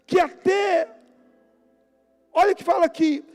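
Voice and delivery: loud, high preacher's declamation; full-force preaching. Acted. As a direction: proclaim the proverb to the congregation.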